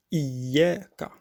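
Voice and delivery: slow Iyyaka with a held, doubled y. Shown as a demonstration. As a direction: lean on the yy in Iyyaka. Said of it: "il faut faire attention".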